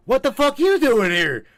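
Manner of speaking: loud, high-pitched mock indignation; hammed-up loud challenge; mock outburst. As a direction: burst out in playful outrage. Mock outburst at guest, played for laughs.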